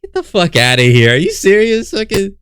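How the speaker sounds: exasperated disbelief shooting up high; real incredulity. Real, not acted. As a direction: react in disbelief, pitch leaping up. Incredulous reaction to the clip.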